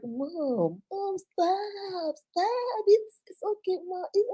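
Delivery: high, soft, drawn-out mock whine; imitating babied son. Son fending off his mother's babying, high soft whining. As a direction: whine it softly like an embarrassed child. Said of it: "make him feel like a baby".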